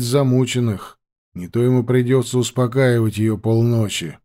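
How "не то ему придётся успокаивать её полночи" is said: ordinary delivery, nothing marked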